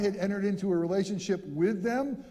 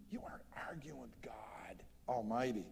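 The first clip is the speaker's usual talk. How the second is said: urgent whispered rebuke; whispered warning; 8 semitones lower, 14 dB quieter than usual. Retold or imitated. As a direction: whisper it like a grave warning from Moses. Moses warning the people.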